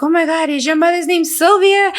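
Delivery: high-pitched, amazed question; retold excited discovery. Relatives asking the daughter about her mother, excited. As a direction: gush it like a long-lost relative.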